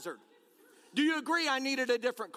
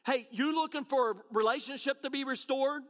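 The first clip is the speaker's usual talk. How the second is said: ordinary talk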